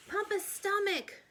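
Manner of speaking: high, loud panicked yell. A narrator's reading. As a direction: shout it out in a panic. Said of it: "yelled Elwood".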